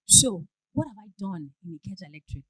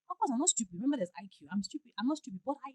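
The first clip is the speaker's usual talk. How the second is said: usual speaking voice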